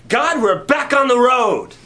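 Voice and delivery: rally them, fired up; excited disciple cheering God on; eager campaigning. Retold or imitated. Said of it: "campaigning like".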